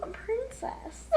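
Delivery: high, soft princess voice. Pretend. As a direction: play a giggly princess.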